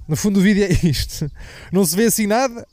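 usual speaking voice

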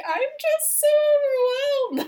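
very high, drawn-out mock wail; retold crying. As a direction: wail it like an exaggerated crying fit, slowly. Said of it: "I just started crying".

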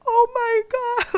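high, slow, breaking "oh my God" like a starstruck fan; awestruck shriek. Retold or imitated. Fan reliving [name] coming up close, shrieking in awe. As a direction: squeal it out almost in tears, as if she's right in front of you. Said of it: "I was just like".

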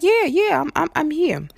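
mock high, loud driver on the phone; driver's voice. Driver on the phone insisting she's there, high mock voice.

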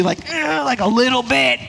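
reluctant offering. Retold or imitated. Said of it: "and you go".

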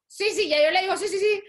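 chirpy fake agreement; brushing them off with breezy yeses; high, loud hollow yes. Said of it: "y es como".

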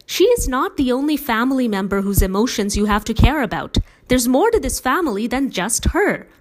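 son finally snaps at his father, loud and angry; losing control. A narrator's reading. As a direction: let the anger burst out loud. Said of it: "I finally lost control".

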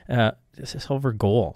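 usual speaking voice

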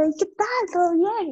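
a hint of high, sing-song politeness; chirpy fake-friendly small talk; lightly mocking the always-smiling host; forced cheerful greeting. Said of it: "El siempre estar como sonriendo".

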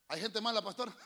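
ordinary delivery

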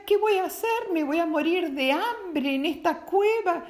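high, whimpering despair; a lightly voiced sobbing boy; crying boy. A narrator's reading. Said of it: "se puso a llorar Aladino". (niño llorando, desesperado)

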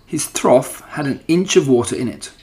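ordinary delivery, nothing marked

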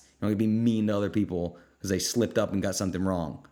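ordinary delivery

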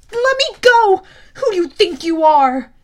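loud, high furious protest; Percy shouting at the girls holding him; angry shout. A narrator's reading. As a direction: fight them with your voice. Percy struggling against captors.

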